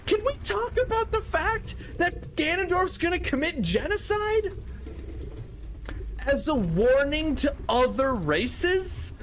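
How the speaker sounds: high-pitched disbelief; incredulous. Real, not acted. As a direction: voice shoots up in appalled disbelief. Incredulous reaction to dark dialogue.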